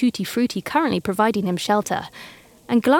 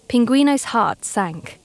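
plain reading voice

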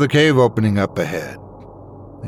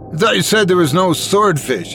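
higher-pitched, understated alarm; a touch of panic, pitched up; mild panic; 8 semitones higher than usual. A narrator's reading. Carlos protesting after the swordfish attack, mildly panicked. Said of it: "his breathing panicked".

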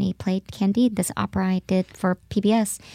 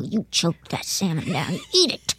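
stern mom voice; 2 semitones lower than usual. Retold or imitated. Mom ordering her daughter to finish the salmon, mock stern. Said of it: "hearing my mom's voice go".

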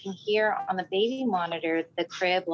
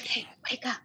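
quiet; 5 semitones higher, 6 dB quieter than usual. Retold or imitated. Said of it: "it was so quiet".

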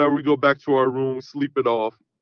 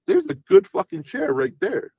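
sarcastic retort; 11 semitones higher than usual. Retold or imitated. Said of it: "And I'm like".

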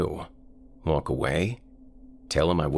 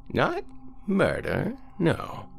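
put-on high, slow, quavering old man; 14 semitones higher, slower than usual. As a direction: croak it like a frail old man.

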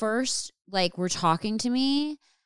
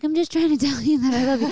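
mock innocent; 6 semitones higher, 5 dB louder, much faster than usual. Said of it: "I'm like".

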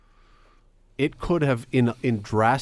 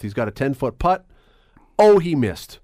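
blurted mock play-by-play, higher on the miss; mock radio commentary with a raised "Oh"; golf commentator parody; 5 semitones higher, 6 dB louder than usual. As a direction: call the missed putt loudly. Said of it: "there's my description of it".

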